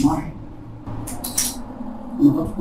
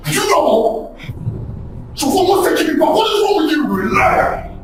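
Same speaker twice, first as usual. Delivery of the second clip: angry quarrel; 3 semitones lower, faster than usual. Acted. Men quarrelling over Brenda.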